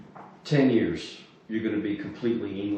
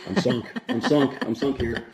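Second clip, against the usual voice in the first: laughing; 3 semitones higher, 7 dB louder than usual. Acted. Losing the ship game.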